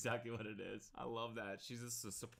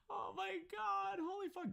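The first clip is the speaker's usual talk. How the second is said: high, slow gasp of disbelief; floored by what he just heard; shocked disbelief; slower than usual. Real, not acted. Streamer reacting in disbelief, high.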